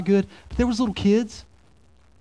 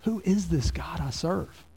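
softer, slow, troubled wondering; troubled question; 7 dB quieter than usual. Retold or imitated. Pastor voicing his own doubt, soft and slow. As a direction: ask it slow and hushed, shaken. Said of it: "it makes me go".

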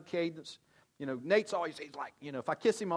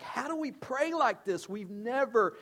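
much higher, puzzled voice slowing on poetry and personal; astonished disciples; 7 semitones higher, slower than usual. Retold or imitated. Disciples asking Jesus how to pray, in a high amazed voice. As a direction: sound amazed at a brand-new way to pray. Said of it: "this is what the disciples are like".

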